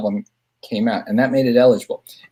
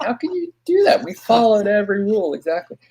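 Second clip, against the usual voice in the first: indignant protest; 11 semitones higher than usual. Retold or imitated. Protesting to the Academy.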